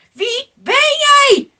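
slow, very high, loud challenge; shouted demand. A narrator's reading. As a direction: shout the question, drawn out. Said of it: "riepen ze". (langgerekt, luid en eisend roepen)

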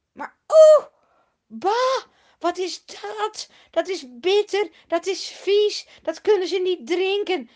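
very high, loud yuck at a bitter taste; disgusted. Acted. Thirsty people tasting the bitter water, disgusted. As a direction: spit it out, grossed out.

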